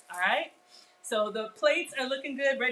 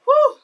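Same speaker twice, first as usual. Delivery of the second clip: loud whew; 16 dB louder than usual. Real, not acted. After setting hot pan down.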